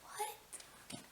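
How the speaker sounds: breathless whispered "What?"; stunned whisper; shocked gasp. Retold or imitated. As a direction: gasp the word out in disbelief. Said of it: "I'm like".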